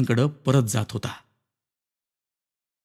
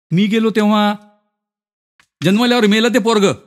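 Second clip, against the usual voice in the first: higher-pitched, blunt villager recounting events; matter-of-fact character voice, pitched up; blunt acquaintance; 6 semitones higher, 3 dB louder than usual. Acted. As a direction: tell the grim news in a raised voice.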